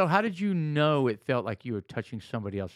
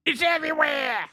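high mock shout; alarmed shout; 12 semitones higher than usual. Acted guest shouting.

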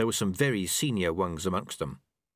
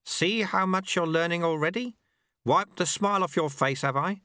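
higher, gently mocking old master; a wry old sweeper teaching his apprentice; old monk Lu Tse; 8 semitones higher than usual. A narrator's reading. Old master speaks to the dojo monks, wry.

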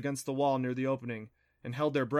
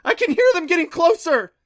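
high, terrified scream; very loud shrieked alarm; 14 dB louder than usual. A narrator's reading. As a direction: scream the warning in panic. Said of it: "Clancy screamed".

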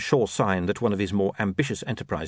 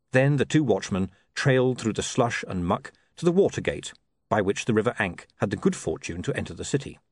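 ordinary delivery, nothing marked

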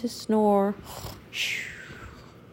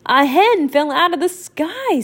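high, excited duck voice; Donald voice; 6 semitones higher than usual. Acted. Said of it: "said Donald".

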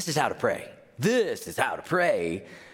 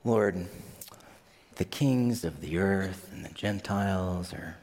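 dutiful rote prayer; 7 semitones lower than usual. Retold or imitated. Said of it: "No, usually we're like".

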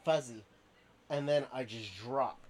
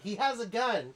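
retold shout; 7 semitones higher, 6 dB louder than usual. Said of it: "someone shouted".

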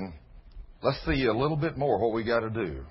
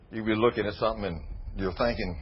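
ordinary talk

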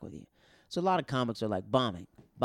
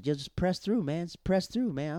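higher, insistent pep talk; urging on; 7 semitones higher than usual. Retold or imitated. Telling fellow comics to keep going, urgently. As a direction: push them on, pitch raised.

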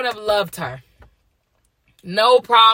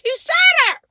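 shouted insistence. Real, not acted. Insisting he shot her.

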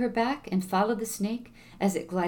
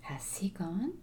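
whisper; 7 dB quieter, slower than usual. A narrator's reading. Hidden snake speaks from pot.

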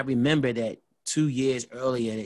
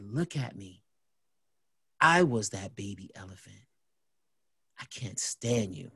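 low, hushed menace with long pauses; scary-serious; 5 semitones lower, 7 dB quieter than usual. Retold or imitated. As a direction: re-enact a cold, quiet reveal. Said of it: "you scaring me".

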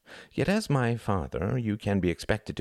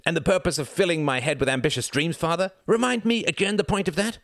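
son railing at his father, louder and higher; indignant rising fury; 11 semitones higher, 4 dB louder than usual. Acted. Son berating his ruined father, loud and indignant. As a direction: pile on the outrage line by line. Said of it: "If you take that tone with me".

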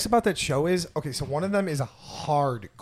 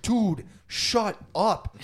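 slow deadpan friend; 5 semitones higher, much slower than usual. Retold or imitated. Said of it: "the other guy's like".

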